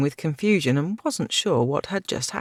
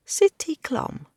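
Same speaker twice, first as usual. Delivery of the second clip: high, slow reluctant answer; hesitant young girl; 14 semitones higher, slower than usual. A narrator's reading. Girl character voice.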